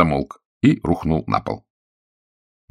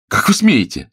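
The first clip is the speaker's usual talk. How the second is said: indignant; 11 semitones higher, 4 dB louder than usual. A narrator's reading. Tatiana grabbed by drunk officer.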